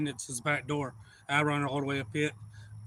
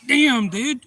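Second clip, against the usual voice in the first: loud, high retold complaint; acted indignant protest; 8 semitones higher, 9 dB louder than usual. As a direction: protest as if just badly startled. Startled man protests to shooter.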